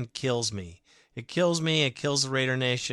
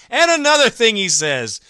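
ordinary talk

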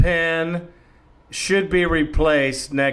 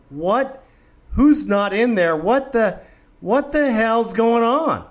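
higher, incredulous retelling; baffled disbelief; 6 semitones higher than usual. Retold or imitated. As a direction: act out bewildered pacing and asking. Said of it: "walked around the living room going".

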